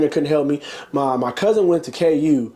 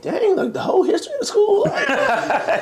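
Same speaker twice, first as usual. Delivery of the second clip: very high, laughing incredulity; amused disbelief. Retold or imitated. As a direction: squeal it in mock shock, half laughing. Retold reaction to coach's sweeping insult.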